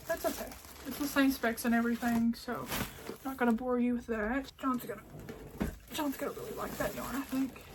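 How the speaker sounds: normal talking voice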